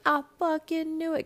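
high-pitched triumphant outburst; exclaimed aloud. Retold or imitated. Viewer reacting to the show's twist, high exclamation. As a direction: cry it out as a called-it moment. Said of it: "I did say that out loud".

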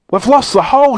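high, dismayed shout. A narrator's reading. Joe tumbling away from the escape, shouting. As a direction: cry out in alarm. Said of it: "shouted Joe".

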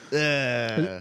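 normal talking voice

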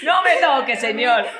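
mock haughty. Mocking friend on plane.

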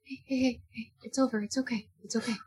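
calm him gently, barely above a murmur; soothing. Acted. Calming a panicked man.

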